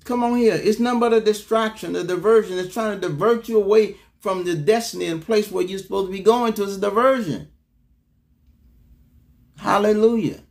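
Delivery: high, urgent preacher's exhortation; impassioned pulpit push, driving the point home; fervent preaching. Real, not acted. Preaches with rising force.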